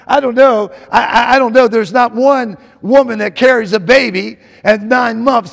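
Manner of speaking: ordinary talk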